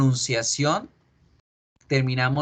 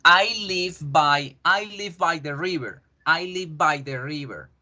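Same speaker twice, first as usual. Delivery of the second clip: slow, careful I live by the river; teacher reading an example slowly; example sentence; 3 semitones higher than usual. Shown as a demonstration. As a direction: spell out the sentence for learners. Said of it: "Si yo les digo".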